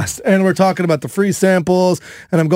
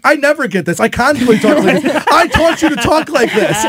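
higher-pitched, playful imitation of an exasperated wife; mock indignant wife; 7 semitones higher than usual. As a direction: protest in a high, mock-offended voice. Imitates wife's indignant complaint.

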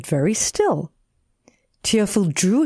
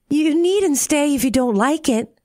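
lightly voiced girl, pitched up in retort; Tearful; 7 semitones higher than usual. A narrator's reading. Tearful answering the frog's complaint, high mild retort. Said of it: "said Tearful".

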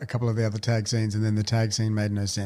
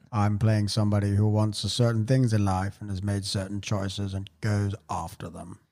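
Morgan Freeman; 4 semitones lower than usual. Acted. Host quotes the actor on his casting, low and measured. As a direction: lower the pitch and let it wind down grandly. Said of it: "It almost sounded like Morgan Freeman".

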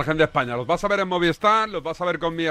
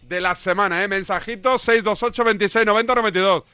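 higher-pitched, projected radio link; radio presenter announcement; 4 semitones higher than usual. Real, not acted. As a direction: announce the segment and phone number to listeners. (locución de radio, tono alto y proyectado)

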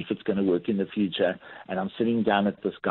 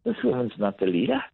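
higher, struck by the realization; amazed inner thought; 11 semitones higher than usual. Retold or imitated. Recalling the tea lady to the host, a little wonderstruck. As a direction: think it aloud in wonder. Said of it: "and I thought".

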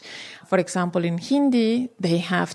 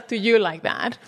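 usual speaking voice

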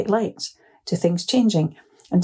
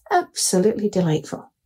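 ordinary talk

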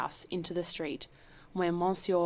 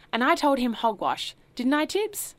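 loud, higher taunting to the guests; mocking; 7 semitones higher, 7 dB louder than usual. A narrator's reading. Host mocking his butler in front of the guests, loud and taunting. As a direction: show off by ridiculing the servant. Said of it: "began to mock his butler".

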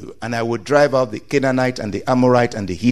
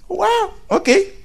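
much higher squeak of surprise; a kid caught off guard, pitched way up; mock surprised child. Preacher voicing a child taken aback by the news, surprised. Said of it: "It's like".

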